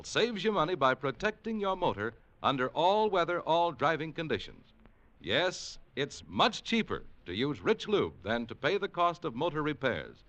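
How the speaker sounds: ordinary delivery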